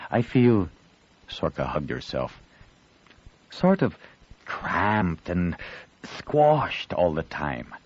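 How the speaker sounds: slow, drawn-out cramped and squashed; lengthened words. Acted. Girl tells boy how the ship feels, slow drawn-out words. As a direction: stretch each word to show the feeling. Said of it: "She lengthened the words".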